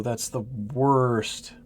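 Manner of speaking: normal talking voice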